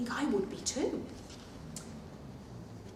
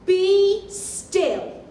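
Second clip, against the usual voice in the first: loud higher command to the monsters; commanding shout; 9 semitones higher, 12 dB louder than usual. Acted. Max commanding the wild things, loud and high. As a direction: order them still like a small king. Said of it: "Because Max said".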